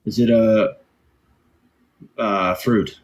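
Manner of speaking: normal talking voice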